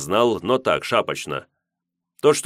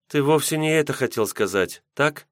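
put-on high-pitched voice of a young heroine; questioning girl; 8 semitones higher than usual. Yulka challenges Misha on his meaning, high questioning girl. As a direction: press him in a higher girl's voice.

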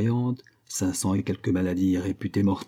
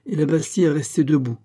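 plain reading voice

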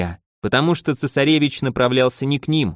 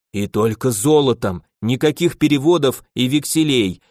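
ordinary talk, nothing marked

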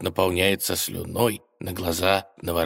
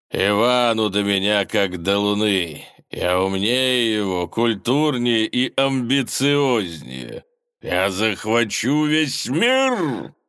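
gloating villain; 4 semitones higher, 4 dB louder, slower than usual. Acted. Villain boasts of conquest.

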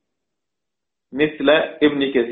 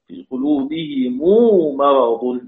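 slow chanted tajweed recitation; Quranic recitation; much slower than usual. Shown as a demonstration. Teacher recites the verse slowly to show the reading.

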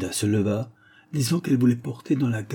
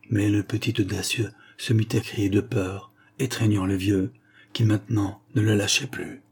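plain reading voice

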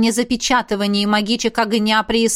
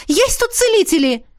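зов на помощь; 10 semitones higher, 3 dB louder than usual. Acted. Зовёт на помощь.

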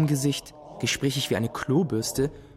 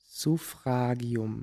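slow reading aloud; 4 dB quieter, much slower than usual. Acted. Boy alone with dictionary, slowly reading headwords aloud. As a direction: sound out each syllable slowly.

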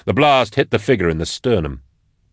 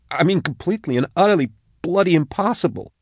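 high-pitched, appalled insistence; emphatic disbelief; 10 semitones higher than usual. A narrator's reading. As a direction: pitch climbs sharply in incredulous protest. Scared woman insists on disbelief.

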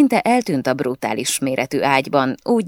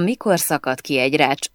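ordinary delivery

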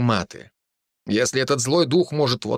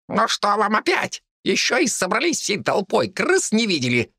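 high, grumpy little rat voice; grumbling rat; 10 semitones higher than usual. A narrator's reading. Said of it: "ворчала она".

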